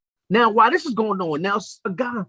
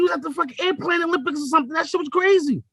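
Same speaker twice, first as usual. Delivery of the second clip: exasperated shout; 7 semitones higher than usual. Real, not acted. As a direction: shout it in disbelief. Shouted disbelief.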